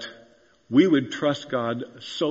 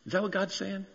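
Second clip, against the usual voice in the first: normal talking voice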